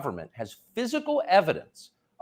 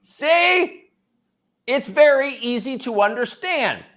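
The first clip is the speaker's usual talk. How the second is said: high, loud, patronising explainer; exaggerated high-pitched mock lecture; mock condescension; 12 semitones higher, 7 dB louder, slower than usual. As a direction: spell it out as if to simpletons. Mocks the clip with patronising explanation.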